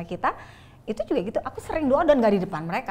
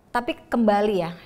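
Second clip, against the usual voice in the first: ordinary delivery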